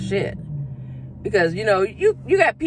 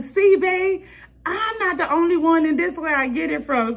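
excited quote to partner; 9 semitones higher than usual. Retold or imitated. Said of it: "I was like".

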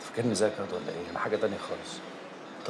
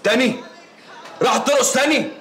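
rising to an outraged, much louder, higher shout; furious shout; 11 dB louder, slower than usual. Acted. Jealous boyfriend yelling at his aunt over the phone, furious. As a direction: explode at the news she's dancing again.

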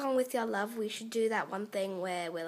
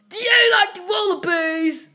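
loud, high Australian voice; Australian accent; 5 semitones higher, 9 dB louder than usual. Acted. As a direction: blurt it loudly in an Australian accent. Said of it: "She sounds like she's talking in an Au-, Australian accent".

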